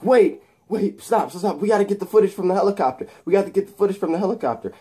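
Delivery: high, fast, frantic Eddie; fast panicky high plea; urgent plea. Retold or imitated. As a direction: rush it out in a panic.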